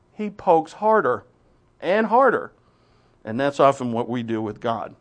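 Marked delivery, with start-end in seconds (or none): 0.1-2.5 rising emphasis. Acted. Building intensity.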